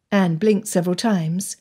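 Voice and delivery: usual speaking voice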